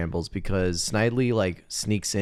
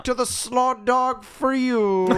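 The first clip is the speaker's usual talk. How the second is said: very high, slow, drawn-out decree; stretched-out mock sentencing; mock banishment; 3 dB louder, slower than usual. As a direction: send them off in a hammed-up high voice. Acting out the demotion to slaw dog.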